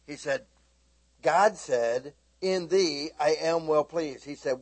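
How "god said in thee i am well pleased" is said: loud, emphatic retelling; insistent declaration. Retold or imitated. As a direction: press it with full force.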